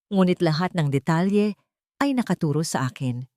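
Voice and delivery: ordinary delivery, nothing marked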